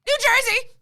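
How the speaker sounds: a high, loud, flustered shout of a name; stunned blurt. Retold or imitated. A starstruck actor blurting her home state to a co-star, loud. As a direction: blurt it out at the top of your voice, starstruck. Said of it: "all I could muster to reply to him".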